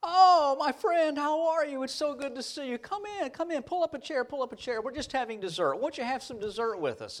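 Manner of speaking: very high, gushing host, gradually settling; effusive welcome. Retold or imitated. Host to young man at the door, effusively welcoming. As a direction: welcome him in like a long-lost friend. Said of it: "Doctor Horn looks up and says".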